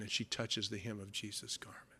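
unvoiced hushed telling; whisper. A narrator's reading. Preacher telling the woman's touch, whispered.

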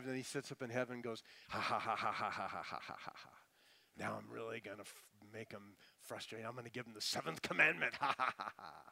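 imitating a gloating god with a mock evil laugh; a sly villain chuckling ha ha ha; mocking cackle. Preacher mocks a killjoy god's cackle at his people. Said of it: "He's the cosmic killjoy".

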